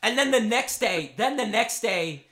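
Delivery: ordinary talk, nothing marked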